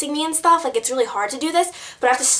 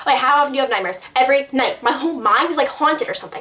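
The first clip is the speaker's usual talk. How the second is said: usual speaking voice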